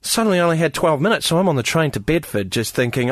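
ordinary talk

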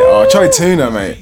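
very high excited shout of the name. Real, not acted. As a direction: blurt the name out, thrilled. Excited exclamation.

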